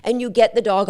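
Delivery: ordinary talk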